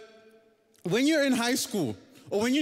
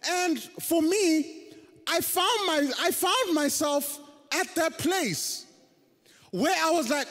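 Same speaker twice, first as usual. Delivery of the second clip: ordinary talk, nothing marked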